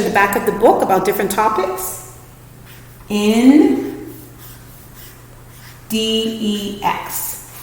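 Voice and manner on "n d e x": very slow letters L E T; teacher spelling letter; spelling out slowly. Shown as a demonstration. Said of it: "spelling index by parts".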